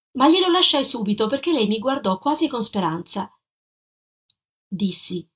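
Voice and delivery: plain reading voice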